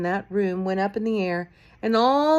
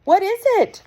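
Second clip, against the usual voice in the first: much higher, loud cry; 14 semitones higher, 8 dB louder than usual. A narrator's reading. Witches cry out to Mildred, high and loud. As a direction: call back in alarm. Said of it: "the others cried out".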